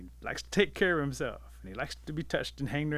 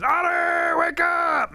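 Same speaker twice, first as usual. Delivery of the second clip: high, full-force scream; 12 dB louder, much slower than usual. Acted. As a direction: scream to jolt a sleeper awake. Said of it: "and he screams".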